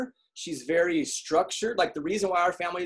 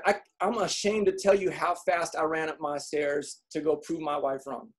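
usual speaking voice

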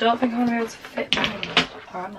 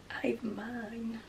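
whisper; 14 dB quieter than usual. Real, not acted. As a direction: whisper it like a guilty secret. Confessing borrowed trousers aside.

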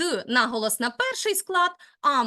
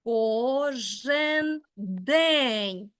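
very slow, each syllable of "Кожен день наголошений" spaced out evenly, stress landing on "день"; reading syllable by syllable; 3 semitones lower, much slower than usual. Shown as a demonstration. Teacher scanning a poem line for a student, very slow and syllable by syllable. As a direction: tap out the metre, one syllable at a time. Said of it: "треба промовити вірш мало не по складах".

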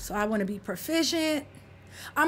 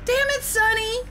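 exasperated yell; 7 semitones higher than usual. Real, not acted. Yelling at Sonny to leave the match, exasperated. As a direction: snap at him in frustration. Exasperated at teammate holding game.

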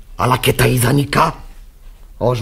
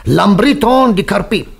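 higher, louder scornful outburst; outraged sarcasm; 8 semitones higher, 5 dB louder than usual. Acted. Pastor scorning her radical reading, loud indignant sarcasm. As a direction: spit out the scorn.

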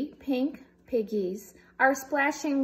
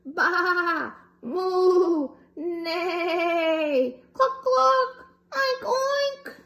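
high animal calls in a row; high playful animal calls; animal noises; 6 semitones higher, 4 dB louder, much slower than usual. Acted. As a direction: run through the farm noises. All animals call out.

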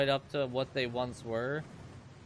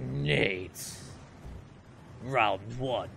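low, booming arena announcer; fight announcer; 5 semitones lower, much slower than usual. Pretend. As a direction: call the round like a fighting game. Fighting-game announcer bit.

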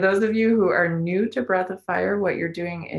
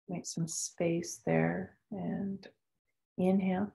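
guided meditation; 4 dB quieter than usual. Acted. Leads a breathing meditation.

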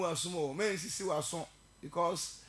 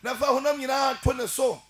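loud, higher fiery preaching; preaching at full force; 5 semitones higher, 6 dB louder than usual. Real, not acted. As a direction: call the congregation to repent, full voice. Preacher rises to full altar call.

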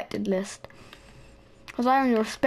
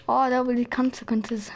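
normal talking voice

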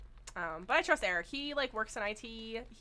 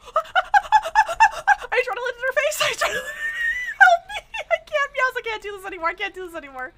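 high, loud frantic pleading; panicked laugh-crying; 12 semitones higher, 13 dB louder than usual. Real, not acted. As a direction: beg for help between laughs while fleeing. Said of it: "I'm just running and crying right now".

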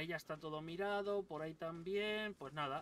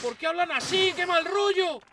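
much higher, much louder freaked-out yell; shocked shout; 11 semitones higher, 14 dB louder than usual. Real, not acted. Gamer creeped out by enemy voices, yelling high and loud. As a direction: yelp in creeped-out alarm. Enemies talk strangely, he reacts.

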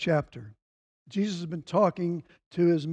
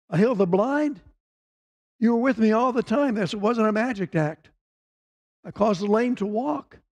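Jesus pressing his case, preached with fervour; preaching at full force; 2 semitones higher than usual. Acted. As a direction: drive each claim home like a fervent preacher.

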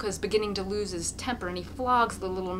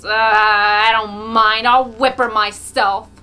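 loud jeering shout; 2 semitones higher, 16 dB louder, slower than usual. A narrator's reading. Someone joins the cruelty.

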